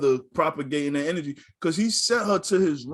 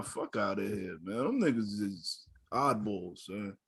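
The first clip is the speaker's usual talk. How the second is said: ordinary delivery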